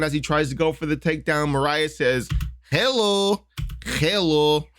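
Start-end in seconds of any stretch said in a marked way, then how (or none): 2.7-4.7 high-pitched mock Russian hello; bad Russian accent. Fight analyst voicing Moraes's uppercut as a greeting, bad Russian accent. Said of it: "can't do a really good Russian accent".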